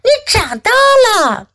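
high-pitched mild yell; startled yell. A narrator's reading. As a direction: cry it out high in surprise. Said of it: "我大喊道".

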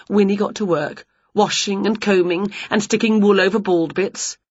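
plain reading voice

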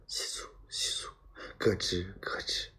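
hushed rustle and creak; whispered eerie noises. A narrator's reading. Narrator repeats night noises haunting the tense emperor, whispered. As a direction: whisper the rustling and gnawing very softly.

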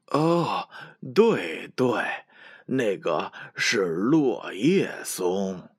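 put-on gently correcting, slow old grandpa; correcting old goat. A narrator's reading. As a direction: set the kids right slowly. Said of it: "山羊爷爷纠正道".

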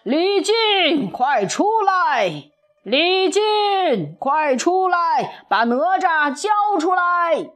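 high, drawn-out calls across the sky; clamouring dragon kings. A narrator's reading. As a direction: call out insistently, stretching the words. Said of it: "不停地叫嚷".

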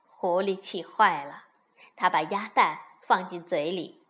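plain reading voice

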